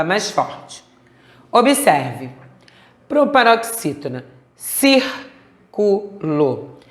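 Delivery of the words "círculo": círculo in three slow pieces, stressed CÍR first; syllable by syllable. Shown as a demonstration. Teacher showing a proparoxítona, syllable by syllable. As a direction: stretch CÍR, then separate CU and LO in círculo. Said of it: "A sílaba tônica é a antepenúltima".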